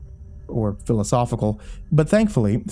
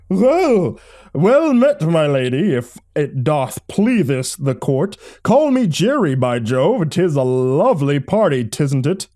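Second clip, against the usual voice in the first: hammed-up olde English gentleman, high and loud; booming theatrical old-fashioned nobleman; old-timey voice; 5 semitones higher, 4 dB louder, slower than usual. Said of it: "you don't have to do the old-timey voice".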